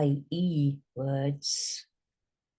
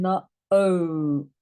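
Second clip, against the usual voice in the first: usual speaking voice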